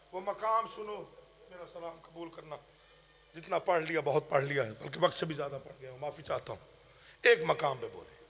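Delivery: low, soft, subdued preaching; hushed sermon delivery. Real, not acted. Preacher apologising to mourners and recalling the Imam's silence, hushed and low. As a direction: drop right down, nearly under the breath.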